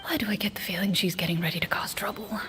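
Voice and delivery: hushed, unvoiced whisper to herself; whispered aside. The game master whispers to herself, suspecting Navia is plotting trouble, hushed aside. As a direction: whisper it under your breath, wary of mischief.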